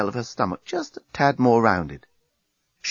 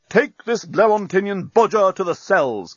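raised, high-pitched order; sharp military command; 8 semitones higher, 3 dB louder than usual. A narrator's reading. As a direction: order it like an angry captain. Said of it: "commanded Bolfas".

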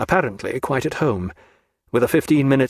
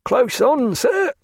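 chuckling; 12 semitones higher, slower than usual. A narrator's reading.

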